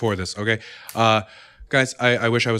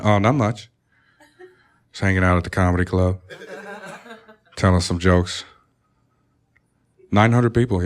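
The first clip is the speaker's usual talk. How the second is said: a lower, smooth crooner voice held through the call; deep smooth voice; 4 semitones lower than usual. Retold or imitated. Husband on the phone to his wife, lightly voiced low crooner. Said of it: "I've been doing this voice".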